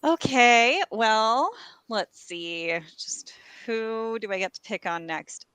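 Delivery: ordinary delivery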